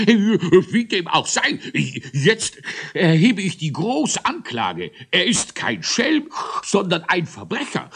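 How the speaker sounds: high, nasal bluster; thundering through the nose. A narrator's reading. Judge brings the grand charge of cake theft, thundering nasally. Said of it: "donnerte gewaltig, wenngleich durch die Nase".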